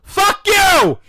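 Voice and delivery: high, loud explosive yell; shouted outburst. Retold or imitated. Clerk's outburst at being told to calm down, shouted. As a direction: blow up at him in a mock fury. Outburst answering the condescending customer.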